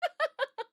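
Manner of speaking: a high, giddy giggle; delighted high laugh. Real, not acted. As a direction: laugh at your own segue. Laughs after segue.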